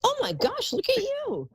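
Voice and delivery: high, bright delighted gasp of praise; delighted gush. Real, not acted. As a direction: gush over her like a fan.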